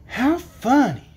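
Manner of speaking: high, drawn-out amused surprise; exclaiming. A narrator's reading. Dorothy seeing dancing knives and forks, exclaiming. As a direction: exclaim it slowly in wonder. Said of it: "exclaimed Dorothy".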